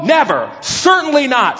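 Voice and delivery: usual speaking voice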